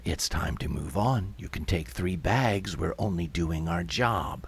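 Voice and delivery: low, soft, impassive worker's voice; indifferent official. Acted. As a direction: dismiss them in a low, unmoved voice. Yellow vest answers shouting crowd.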